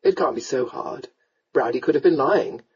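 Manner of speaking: low man's voice, persuasive; coaxing lord. Acted. Lord persuades his sweetheart, coaxingly. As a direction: reason with her gently, low.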